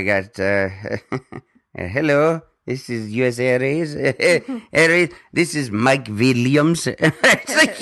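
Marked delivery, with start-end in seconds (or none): 1.7-6.9 mock Indian call-center agent accent; hammed-up Indian accent phone greeting. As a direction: imitate the airline agent in a light Indian accent. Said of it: "You're falling out of that accent quickly".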